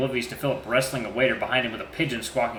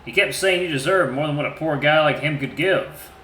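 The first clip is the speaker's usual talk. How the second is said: normal talking voice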